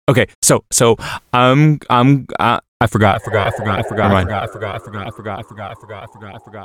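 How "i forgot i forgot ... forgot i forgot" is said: trailing off into silence; stoner fading out. Pretend. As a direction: fade from plain to barely audible. Stoner forgets, voice fades away.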